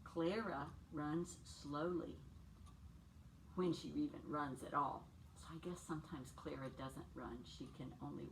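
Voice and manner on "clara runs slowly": slow read. Acted. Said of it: "And he says".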